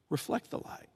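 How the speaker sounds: hushed whisper of Reflect the light. Acted. Preacher whispering Jesus's words to the congregation. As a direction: breathe the words out.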